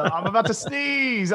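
high squeaky warning. Retold or imitated. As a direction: pipe up high like a sneeze is coming. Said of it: "I was giving you the".

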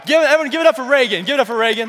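loud, high hype-man call; rallying applause. Real, not acted. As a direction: whip the crowd into applause.

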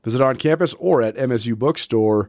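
radio host reading a sponsor spot; live ad read. Acted. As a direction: pitch the bookstore sale to listeners.

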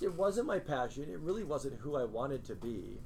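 ordinary talk

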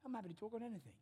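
high, fast breezy offer; lightly voiced easygoing crowd-pleaser; casual Jesus. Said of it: "And go".

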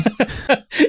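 sudden high burst of laughter; high giggle. Real, not acted. Cohost laughing at his own jab, high giggle. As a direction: giggle high and breathy at the joke.

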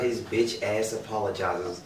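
ordinary talk, nothing marked

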